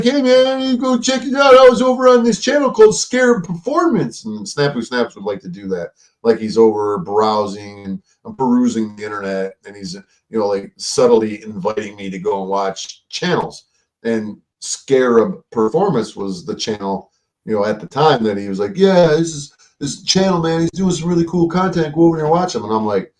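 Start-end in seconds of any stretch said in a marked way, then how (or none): 0.0-4.2 very high-pitched put-on voice; Snafu Snaps. Snafu Snaps inviting him to watch a channel, high impression. 18.8-22.6 high put-on voice; Snafu Snaps. Snafu Snaps urging him to watch, high impression.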